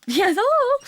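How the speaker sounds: high, loud laughing cry. Real, not acted. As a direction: burst out laughing. Laughing burst while telling story.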